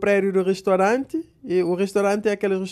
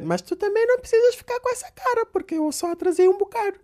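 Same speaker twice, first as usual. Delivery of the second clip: high mock wife voice, much higher; imitating his wife; 13 semitones higher than usual. Husband mimicking his wife's excuse in a high mock voice. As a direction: whine it like the wife caught being late. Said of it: "E a minha mulher a dizer".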